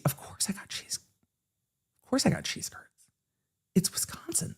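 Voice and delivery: hushed, voiceless confiding aside; playful whisper. Real, not acted. Streamer confiding to viewers about Wisconsin cheese, whispered. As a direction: whisper it like an obvious secret.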